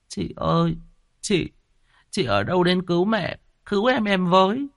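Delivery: put-on high, crying little boy's plea; tearful child. A narrator's reading. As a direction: sob out the call for help. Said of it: "vừa khóc, vừa lẩm bẩm". (đứa bé vừa khóc vừa lẩm bẩm)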